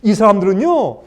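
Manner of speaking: usual speaking voice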